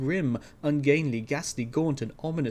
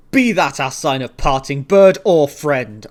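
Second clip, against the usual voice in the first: shrieked; 14 dB louder than usual. A narrator's reading. Narrator driving the raven out, loud shriek. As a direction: scream the parting sign. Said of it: "I shrieked up starting".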